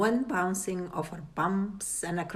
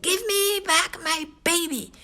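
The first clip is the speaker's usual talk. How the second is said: fish voice; 11 semitones higher than usual. A narrator's reading. Big fish demands baby.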